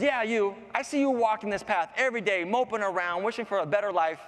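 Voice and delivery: high, chiding flower voice; talking flower. Acted. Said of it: "Flower goes".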